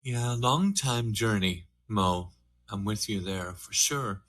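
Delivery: ordinary talk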